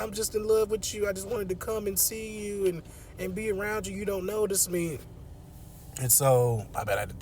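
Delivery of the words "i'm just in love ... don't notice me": imitating a pleading girl, much higher; high girly plea. Captive girl pleading her love to the killer, higher mock voice. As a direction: beg him in a higher girly voice.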